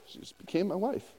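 quiet sarcastic rise on "wife"; incredulous mock question. Shown as a demonstration. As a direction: act baffled that "She just became my wife". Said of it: "What happened?".

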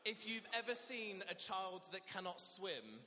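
ordinary talk, nothing marked

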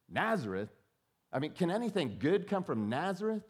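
mock the idea of Nazareth; skeptical scoff. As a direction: Nathanael scoffing at Philip's news. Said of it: "That's his response".

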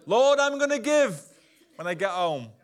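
high, loud mock-eager promise; mock eager churchgoer; eager pledge. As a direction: gush like a pledge-maker.

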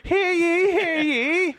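very high, loud crier's call; mock town crier. Joking about the bone meister, mock town crier call. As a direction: call out a hammed-up proclamation.

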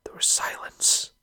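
hushed, breathy whisper, slow. Real, not acted. Telling mates the room went dead, slow whisper. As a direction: drop to a whisper for the dead silence. Crowd goes silent after defeat.

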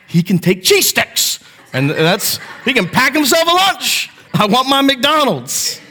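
a kid's mock snap, insisting on McDonald's; son snapping back. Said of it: "he snaps back".

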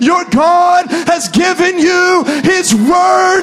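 loud, rising pulpit preaching; full-force preaching. Real, not acted. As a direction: preach it hard and build to a roar.

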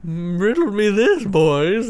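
slow, high villain riddle; riddler voice. Retold or imitated. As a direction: drawl it like a riddling game master. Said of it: "he's like".

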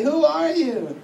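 high-pitched acted call. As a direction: call out to the unseen voice. Calls back to unseen voice.

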